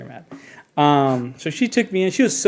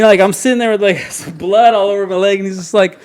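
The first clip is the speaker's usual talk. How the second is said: ordinary delivery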